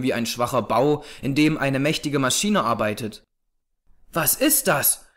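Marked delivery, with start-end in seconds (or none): 4.1-5.1 mildly alarmed question; understated startled cry; startled exclamation. A narrator's reading. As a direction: ask it with a touch of shock. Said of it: "rief ich".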